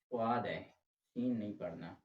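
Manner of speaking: normal talking voice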